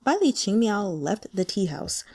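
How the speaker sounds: plain reading voice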